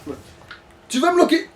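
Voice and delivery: usual speaking voice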